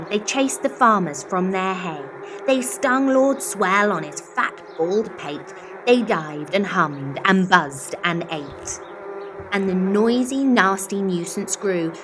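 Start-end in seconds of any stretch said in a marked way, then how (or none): none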